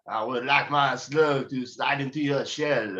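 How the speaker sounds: slow, lightly French come-on; a hint of a sleazy Frenchman, drawn out; slow mock French seduction; French seducer. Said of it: "dirty French man there".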